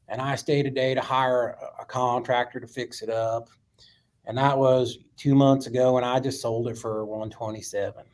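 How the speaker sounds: put-on old Florida guy's country accent; imitated deep Florida accent. Old Bob telling the room about his house deal, deep Florida accent. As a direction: tell it plainly as an elderly Florida country man. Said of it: "he had this deep accent".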